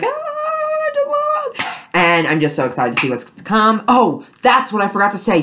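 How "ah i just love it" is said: very high, slow swooning squeal; gushing fangirl. Retold or imitated. Fan reacting to the episode, high slow swoon. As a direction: melt into a long squeaky swoon. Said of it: "I was just like".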